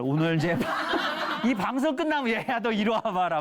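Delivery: high, gushing mock parent call; overeager parent. Parent after watching the show, high mock eager voice. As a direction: ham up a parent suddenly keen to read.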